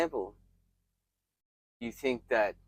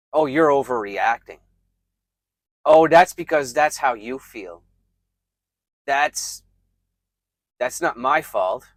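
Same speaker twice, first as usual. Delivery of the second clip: loud, defensive brush-off; acted loud deflection; dismissive blame-shifting; 2 semitones higher, 12 dB louder than usual. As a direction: throw the blame back, loudly. Acted blame-shifting replies of narcissist.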